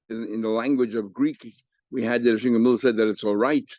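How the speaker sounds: usual speaking voice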